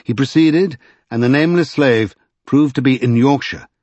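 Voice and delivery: ordinary delivery, nothing marked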